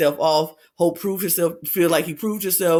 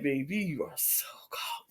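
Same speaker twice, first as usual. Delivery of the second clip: mock confiding aside; 3 semitones lower, 13 dB quieter than usual. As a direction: drop to a much softer voice, lightly teasing. Aside to the caught man.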